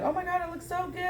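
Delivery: high, delighted gush; mock gushing. Mock gushing over decoration.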